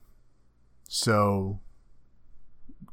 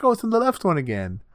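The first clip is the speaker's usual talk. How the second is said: faster mock butler; 8 semitones higher, faster than usual. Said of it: "but faster".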